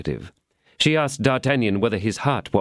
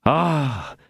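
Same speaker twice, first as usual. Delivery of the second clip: exclaiming; 6 semitones higher than usual. Acted. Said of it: "exclaimed D'Artagnan".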